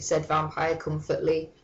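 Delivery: usual speaking voice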